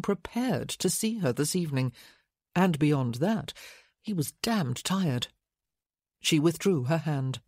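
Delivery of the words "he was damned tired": usual speaking voice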